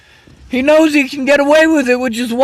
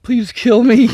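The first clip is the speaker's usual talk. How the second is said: hopeless plea; 3 semitones lower than usual. Real, not acted. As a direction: say it drained and beaten. Despairing plea after rant.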